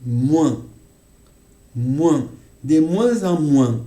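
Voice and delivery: "moins" with a nasal vowel, then "moins-z-en moins" linked, firm; moins demo. Shown as a demonstration. Teacher drilling students on "moins en moins". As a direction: link "des moins-z-en moins" and lean on it. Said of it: "l'on entend moins".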